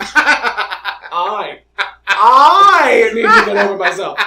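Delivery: cracking up while repeating the insult; laughing disbelief. Real, not acted. Repeating mother's dismissive advice to the co-host, laughing hard.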